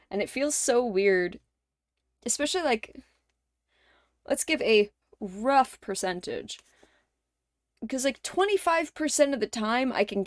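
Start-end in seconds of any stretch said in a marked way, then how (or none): none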